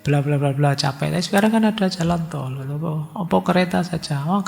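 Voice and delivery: ordinary talk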